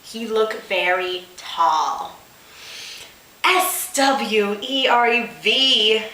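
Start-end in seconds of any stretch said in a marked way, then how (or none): 3.4-6.0 slow sassy letter-by-letter spelling, well above the voice. Acted. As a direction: spell it out with attitude. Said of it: "she said with much sass".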